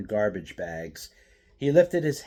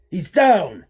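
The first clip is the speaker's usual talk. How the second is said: loud triumphant shout; 10 semitones higher, 13 dB louder than usual. A narrator's reading. Thug yelling to his gang, loud shout. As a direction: yell it out as he falls. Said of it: "said Raikou".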